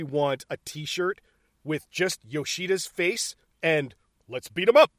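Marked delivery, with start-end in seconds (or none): 4.3-4.9 loud slogan pitched up at the end; higher, louder imitation of the boss's order; mock movie catchphrase. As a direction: punch it out as a quoted film line. Quoting slogan for the t-shirt.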